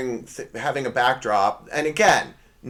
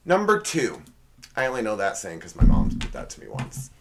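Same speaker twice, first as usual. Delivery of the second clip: ordinary talk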